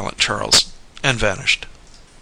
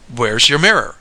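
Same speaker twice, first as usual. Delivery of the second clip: loud, abrupt demand; 5 dB louder than usual. A narrator's reading. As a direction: demand it with force. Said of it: "he demanded".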